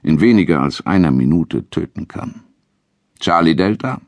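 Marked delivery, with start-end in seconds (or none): 3.2-4.0 controller calling over the radio; a crisp radio call, rising; radio voice in headphones. A narrator's reading. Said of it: "Die Stimme des Flugleiters, die in meinen Kopfhörern klang".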